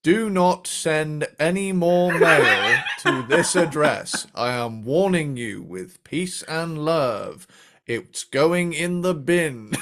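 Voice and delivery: very slow, drawn-out Beatle impression; [name]. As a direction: stretch out each word as a ponderous public warning. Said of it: "[name] sounds a little more like".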